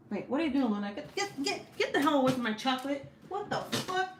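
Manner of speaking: high-pitched, urgent real scolding; exasperated, pitch jumping way up; scolding the cat. Real, not acted. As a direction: shoo a pet away from your food. Scolding cat off her chocolate.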